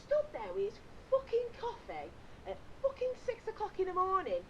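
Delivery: high-pitched comic rant; livid. Retold or imitated. Ranting about the man across the campsite, livid. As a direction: spit out the insult in mounting outrage. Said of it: "I were fucking livid".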